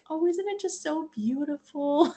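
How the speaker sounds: high, airy gushing coo; sing-song, very high, dreamy gush; Shay. Retold or imitated. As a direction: imitate a friend cooing with delight. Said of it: "if you know Shay, she's like".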